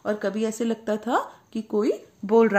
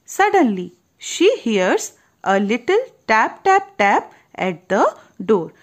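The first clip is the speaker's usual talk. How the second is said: slow, deliberate read-aloud storytelling; storybook narration; 6 dB louder, much slower than usual. A narrator's reading. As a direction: draw each word out for a child. Reads English storybook line slowly. (धीमी कहानी सुनाने वाली आवाज़)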